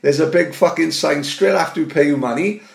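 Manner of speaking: ordinary talk, nothing marked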